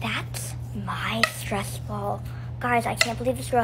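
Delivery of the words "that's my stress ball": tearful whisper. Acted. Sees her lost stress ball.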